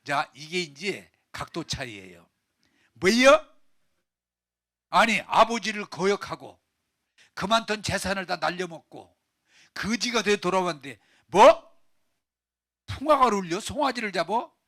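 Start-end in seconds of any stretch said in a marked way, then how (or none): 2.9-14.6 loud, high protests in disbelief; indignant older brother. Acted. Older brother learning of the feast for his brother, indignant. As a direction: throw the outraged questions out, raised and forceful.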